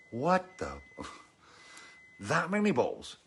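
high-pitched incredulous reaction to a bill; shocked disbelief. Retold or imitated. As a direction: act out being stunned by the total.

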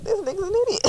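high, teasing quoted put-down; mock deadpan judgment. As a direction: pitch it up and sneer a bit. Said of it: "and she was like".

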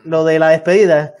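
ordinary talk

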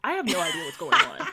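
high laughing confusion. Retold or imitated. Laughing retold bafflement.

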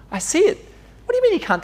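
high, loud incredulous outburst. Retold or imitated. God protesting to a doubter, high loud disbelief.

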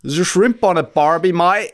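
high, loud Australian caricature; hammed-up fake Australian; mock Australian accent. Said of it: "That's Australian".

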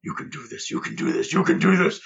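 hushed self-encouragement, building up; whispered pep talk. Acted. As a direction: whisper it, psyching itself up. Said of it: "and you hear it say".

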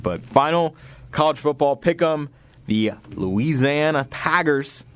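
bad Southern accent, slow drawl; lightly put-on Southern coach voice; Brian Kelly impression. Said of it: "I was trying to do a Brian Kelly impression".

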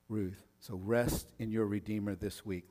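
ordinary talk

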